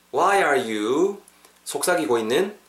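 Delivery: ordinary delivery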